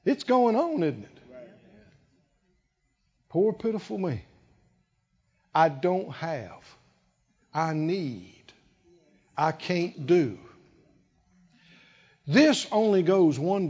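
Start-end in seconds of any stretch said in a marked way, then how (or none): 3.3-10.4 soft, self-pitying mock whine; quiet mock whining; pitiful whine. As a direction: moan like a self-pitying believer. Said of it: "as long as you're whining".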